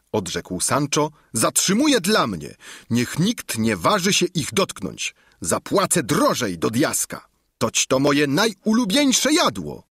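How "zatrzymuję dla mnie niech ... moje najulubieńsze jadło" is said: eager, higher squire; Sancho's voice. A narrator's reading. Sancho claims the ox feet, eager and higher. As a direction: claim the dish greedily.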